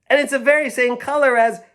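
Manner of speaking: put-on children realizing the truth; excited discovery. Children spotting the wool nest, high and excited. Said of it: "they said together".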